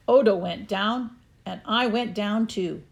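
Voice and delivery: ordinary delivery, nothing marked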